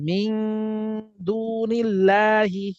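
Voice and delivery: মিং hummed long, then দুনিল্লাহি with stretched vowels; slow chanted recitation, holding the ng of মিং; recitation with ghunnah. Shown as a demonstration. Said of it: "অনুসার মতো উচ্চারণ করতে হবে".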